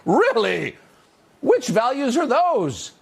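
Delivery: high, slow mocking disbelief; incredulous sneer; sarcastic disbelief. Real, not acted. Sarcastic retort to ad.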